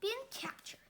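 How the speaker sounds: usual speaking voice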